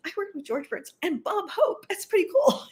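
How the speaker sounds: high-pitched, tickled retold brag; retold boast. Co-host recalls bragging about working with famous comics, excited, high voice.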